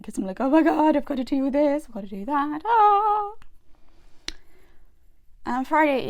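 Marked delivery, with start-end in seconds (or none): 0.4-3.3 loud, high-pitched flustered outburst; mock panic. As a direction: act out panicking, ending on a long high wail. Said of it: "that's when I'm panicking".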